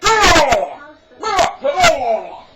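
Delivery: high, loud cartoon panic; squealing cartoon protest; frantic stain voice. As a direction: voice a little stain begging no. Talking stain in a commercial.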